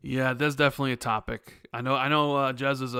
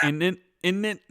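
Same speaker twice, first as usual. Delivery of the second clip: brief mock-British questioning tag; badly faked British accent, rising; 6 semitones higher than usual. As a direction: toss out a would-be British "innit". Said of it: "a British accent next time".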